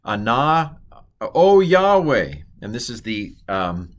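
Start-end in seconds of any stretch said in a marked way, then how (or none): 0.0-2.3 high, loud, drawn-out invocation; acted cry up to God; sailors praying. Teacher voicing sailors calling on God, acted plea. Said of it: "they're praying".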